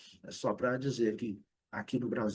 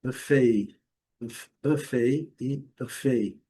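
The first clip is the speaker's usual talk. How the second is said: buffet as BUF-ay, then buf-AY; British then American stress; 6 dB louder, much slower than usual. Shown as a demonstration. Teacher applies the ballet rule, contrasting stress demo. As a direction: slowly shift stress from first to second syllable. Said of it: "na mesma situação".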